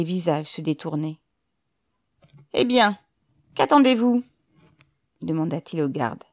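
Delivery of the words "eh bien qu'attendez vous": loud, higher-pitched commissioner; raised voice, pushing for action; impatient demand. A narrator's reading. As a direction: demand it loudly of the guard. Commissioner ordering his guard about.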